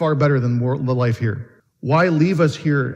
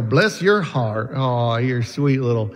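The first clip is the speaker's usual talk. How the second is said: slow, drawn-out mock Southern sweetness; affected Southern drawl; slower than usual. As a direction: stretch it out in a put-on Southern drawl. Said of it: "I don't mean adopt these affectations".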